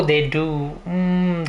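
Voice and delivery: normal talking voice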